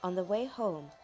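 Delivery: usual speaking voice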